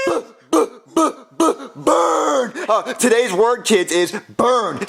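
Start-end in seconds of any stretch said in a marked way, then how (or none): 0.1-2.5 stuttered taunt. Acted. Stammering build-up to a taunt.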